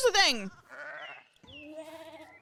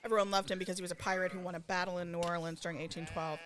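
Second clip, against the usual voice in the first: fast reading; 5 semitones lower, 9 dB quieter, faster than usual. Real, not acted. Reading aloud quickly.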